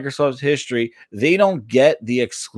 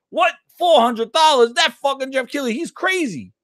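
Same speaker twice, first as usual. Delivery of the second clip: high-pitched stunned outburst, retold; reliving the shock of a crazy price; shocked disbelief; 9 semitones higher than usual. Remembering the price tweet on the toilet, shocked and high. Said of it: "I was like".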